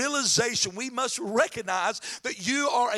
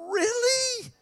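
high-pitched drawn-out disbelief; a high, incredulous "really?"; incredulous parent; 13 semitones higher than usual. Acted. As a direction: squeal it as an exasperated question. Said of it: "there are some times that I go".

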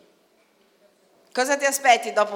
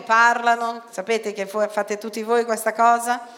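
ordinary talk

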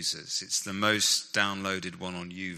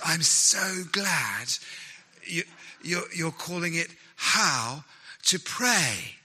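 put-on Nicky's British accent; 2 semitones higher than usual. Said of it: "I'm gonna stop doing the accent here".

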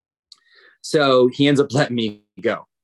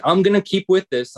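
higher, louder retold resolve; defiant resolve; 5 semitones higher, 4 dB louder than usual. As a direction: dig in, voicing your own stubborn thought. Retelling his defiant resolve.

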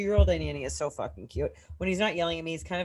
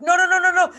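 much higher, louder rising no's; alarmed yell; 11 semitones higher, 7 dB louder, faster than usual. Retold or imitated. Nanny retelling her alarmed shout at the running toddler. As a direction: call out as the kid runs to the water.

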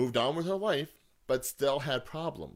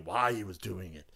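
normal talking voice

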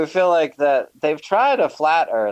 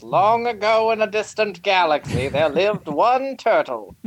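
epic movie trailer narrator; grand booming space narration; 5 semitones higher than usual. Acted.

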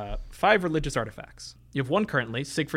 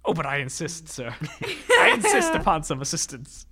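laughing in character; 3 semitones higher, 3 dB louder than usual. Acted. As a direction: insist while cracking up. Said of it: "speaks in a voice like this".

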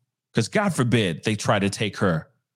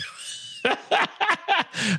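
high cackling laugh; bursting into laughter; 4 dB quieter than usual. Real, not acted. Streamer cracking up at own bad accent, high laughter. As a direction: crack up helplessly, high-pitched.

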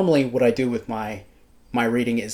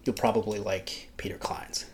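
whispering; 2 semitones lower, 8 dB quieter than usual. Real, not acted. Aside tip said under the breath.

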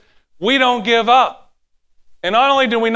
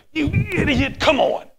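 higher, exasperated scold at the dog; mock scolding dog owner; 9 semitones higher than usual. Owner who finally finds his lost dog, mock exasperated scold. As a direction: snap at a runaway dog, half joking.

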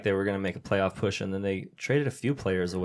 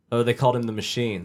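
normal talking voice

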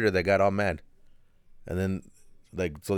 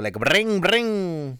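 high, louder mock ringing; imitating a ring; 8 semitones higher, 4 dB louder, much slower than usual. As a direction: ring it out like a buzzing phone. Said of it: "You sounded like a motorcycle".

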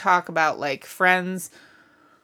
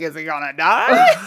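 laughing mock worry; 8 semitones higher, 4 dB louder than usual. Mimicking a character while laughing.

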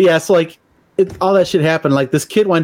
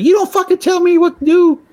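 high mocking mom voice, louder; 3 dB louder than usual. Mother scolds son for talking back, high mocking voice. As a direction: ham up the outraged mother.

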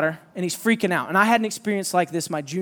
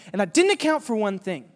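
ordinary talk

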